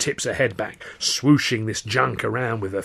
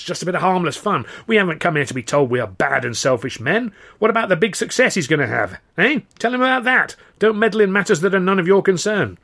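high, loud indignant tirade; scolding protest, building in heat; telling off; 8 semitones higher, 6 dB louder than usual. A narrator's reading. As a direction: give a right telling-off, pitch jumping up. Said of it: "a right telling off".

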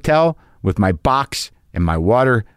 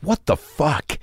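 higher, disbelieving mock outrage kept half under the breath; a hushed, higher what-the-fuck of disbelief; incredulous outrage; 5 semitones higher, slower than usual. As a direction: act stunned and furious at the hotel. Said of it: "I'm like".

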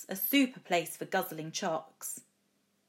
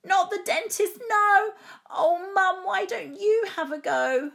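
high, loud wailing protest; child's loud, whiny begging; pleading shout; 11 semitones higher, 7 dB louder than usual. A narrator's reading. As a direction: cry out to mum in high-pitched panic. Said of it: "I shouted".

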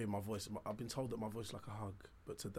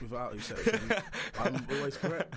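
mock-arrogant boast; 5 semitones higher, 8 dB louder than usual.

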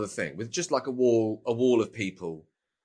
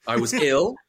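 mock defensive; 4 semitones higher, 5 dB louder than usual. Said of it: "that's my party line".

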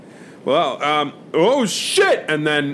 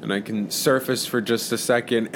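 ordinary delivery

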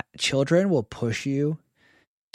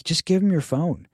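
imitating the painter; 6 semitones higher than usual. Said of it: "He's like".

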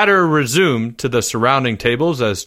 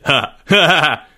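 mocking laugh; 3 semitones higher, 3 dB louder than usual. A narrator's reading. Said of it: "laughed Greg".

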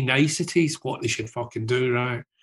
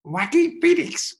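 much higher, slow squeaky voice; mad wee voice; 3 dB louder, slower than usual. Retold or imitated. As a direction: say the name in a silly tiny voice. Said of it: "this wee guy goes".

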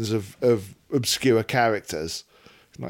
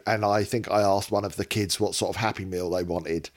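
ordinary delivery

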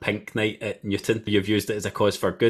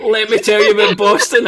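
much higher, louder teasing voice; mocking impression; 12 semitones higher, 9 dB louder than usual. Host teasing runner guest about Boston. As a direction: lampoon her endless Boston talk. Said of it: "I knew that was coming".

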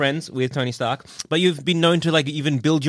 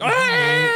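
high scream. Pretend. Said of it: "so you're like".

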